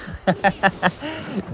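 a short, high, breathy laugh; rueful laugh. Real, not acted. Gamer laughing at giving away a tower, rueful laugh. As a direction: laugh it off in disbelief.

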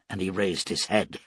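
ordinary delivery, nothing marked

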